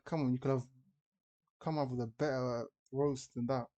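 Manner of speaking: ordinary talk, nothing marked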